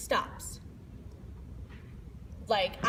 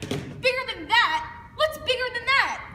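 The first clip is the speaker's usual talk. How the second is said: high-pitched, indignant questioning; incredulous; 11 semitones higher, 4 dB louder than usual. Acted. Kid challenges the narrator about the story, incredulous. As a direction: ask it high and loud in disbelief.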